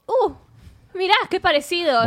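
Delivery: mock the dull amazement of movie characters; mock surprise. Movie characters spotting a lookalike, hammed-up mock surprise.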